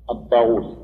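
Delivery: ordinary talk, nothing marked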